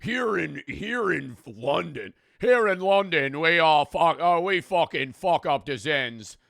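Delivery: loud, put-on braggart voice; drawn-out, swelling mock swagger; mocking boast. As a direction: boast loudly as a mocked Londoner. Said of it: "sit here and be like".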